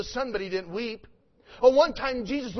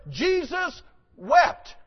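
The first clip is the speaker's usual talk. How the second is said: Jesus wept said slowly and higher, each word weighted; slow emphasis; 5 semitones higher, 5 dB louder, much slower than usual. Shown as a demonstration. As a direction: draw out Jesus wept with reverence. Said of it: "we know the verse".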